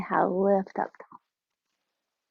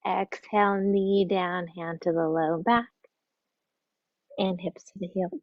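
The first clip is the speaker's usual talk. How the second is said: normal talking voice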